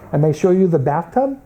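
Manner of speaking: ordinary talk, nothing marked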